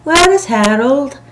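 high, loud call from the veranda. A narrator's reading. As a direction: call to someone outside. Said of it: "calling from the veranda".

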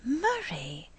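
astonished realization; thunderstruck. A narrator's reading.

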